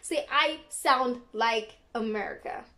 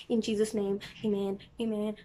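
lightly playful, softer prayer ending; mock prayer; 3 semitones lower, 6 dB quieter than usual. Vlogger jokingly ends her accent explanation as a prayer, mock-prayerful. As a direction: close it off like a quiet mock prayer. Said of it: "it's not even a prayer".

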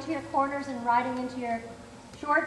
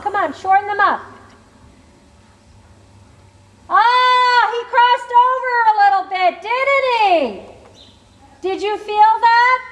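projected riding commands; 3 semitones higher, 7 dB louder than usual. Real, not acted. Riding instructor calling across arena.

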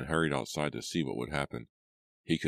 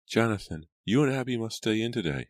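higher, lightly voiced mother; a hint of a mother giving orders, pitched up; instructing mother; 8 semitones higher than usual. A narrator's reading. Mother tells her children to stay in, mildly insistent.

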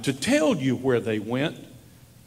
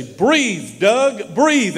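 rising, louder pleading cry of a mother; urgent call; 8 semitones higher, 7 dB louder, much slower than usual. Retold or imitated. Mother at the hospital bed urging her son to breathe, rising urgent call. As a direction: call him back, louder each time.

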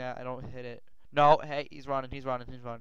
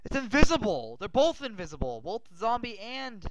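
shocked outcry; 9 semitones higher, 8 dB louder than usual. Real, not acted. Invisible mobs attacking.

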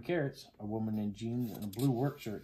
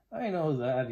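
higher, louder nervous protest; nervous laugh; 4 semitones higher, 5 dB louder than usual. A narrator's reading. Lizzie answers her friend's reassurance, laughing nervously. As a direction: brush it off with nervous bravado. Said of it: "Lizzie laughed nervously".

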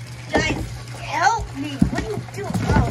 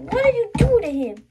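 much higher, fast, loud; high alarmed cry; 9 semitones higher, 4 dB louder, much faster than usual. Acted. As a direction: blurt it out in alarm. Shocked at friend's harm.

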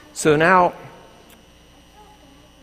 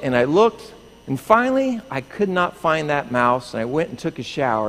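usual speaking voice

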